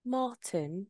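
Martin in a put-on English accent; soft attempt at a British Martin. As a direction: say Martin as if from England, R softened. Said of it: "England would be like".

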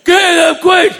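a front-row fan shrieking the show title over and over; fan yelling. Retold or imitated. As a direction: scream the show name like a crazed fan. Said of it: "one guy in the front is just going".

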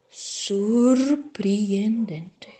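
slow, breathless whispered amazement; amazed whisper. Acted. Gruffalo, stunned by the owl fleeing, whispering slowly. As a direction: breathe it out in wonder. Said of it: "falou espantado".

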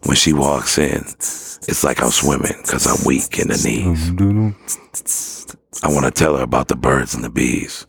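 slow open-mic poetry with long pauses; measured beatnik recital, each phrase left hanging; spoken word poem. Shown as a demonstration. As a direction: deliver it like coffee-house spoken word. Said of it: "kick it and do spoken word".

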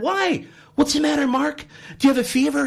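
a coach anxiously checking on his swimmer; worried coach. Acted. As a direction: coach fussing over his star swimmer.

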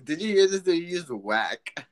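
normal talking voice

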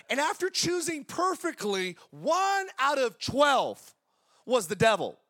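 slow, rising preacher's build to the reveal; preaching at force. Acted. Pastor telling the church one apostle was the devil, building. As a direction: drag it out, then land the devil.

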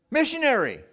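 high, loud ironic emphasis; scare-quoted. Real, not acted. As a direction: set the word off in pointed disbelief. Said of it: "quote unquote".

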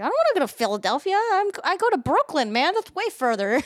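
high, loud, indignant outburst; mock alarmed protest. Retelling reply to taxi guy, loud mock protest. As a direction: blurt it out in hammed-up alarm. Retold alarm at going to Philadelphia.

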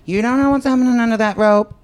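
loud, fast and high joking; excited. Real, not acted. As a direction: push it up, teasing.

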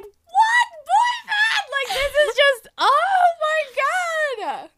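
high, loud, slow shocked cry; shocked shout. Acted. Friend voicing Michael hearing the news, shocked shouting. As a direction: scream it like the friend can't believe it.

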